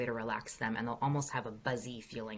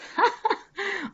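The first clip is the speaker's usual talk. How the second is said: high delighted laugh; real burst of laughter; 6 dB louder than usual. Real, not acted. Coach laughing at a viewer's Trump lips question, real laugh. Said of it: "Oh my God, that's great".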